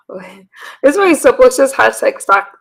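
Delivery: ordinary delivery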